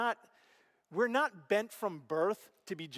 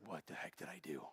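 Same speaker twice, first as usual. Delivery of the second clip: very low, soft self-reproach; hushed regret; 13 semitones lower, 15 dB quieter than usual. Retold or imitated. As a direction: mutter to yourself in stunned regret. Retold regret in the empty garage.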